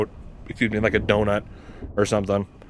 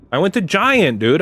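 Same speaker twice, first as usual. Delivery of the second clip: ordinary delivery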